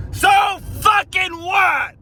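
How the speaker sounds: slow, high outraged yell; angry shout. Real, not acted. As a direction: fling it back in outrage. Outraged retort.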